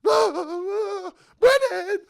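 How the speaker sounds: high, loud, frantic cry of a name; panicked scream. Shown as a demonstration. Friend collapsed in the arena, shrieking his name in panic. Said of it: "I can't be like".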